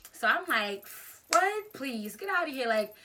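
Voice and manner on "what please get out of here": high, loud incredulous "what"; acted-out cocky dismissal; retold scoffing bravado. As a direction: wave off the challenge loudly. Scoffing bravado before the fight.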